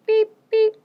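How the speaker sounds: high, loud toy horn beep; squeaky horn beep. Acted. Teacher reading the refrain, loud squeaky horn beep.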